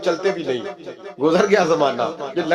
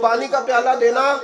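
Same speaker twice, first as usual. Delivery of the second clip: calling out; 8 semitones higher than usual. Retold or imitated. Father asks for water again.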